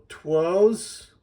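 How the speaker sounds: Two's said "twohz" with the w sounded; high, loud, questioning "TWOHZ?" for Two's; confused misreading. Retold or imitated. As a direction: puzzle out Two's with a w, rising sharply. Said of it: "because they're gonna go".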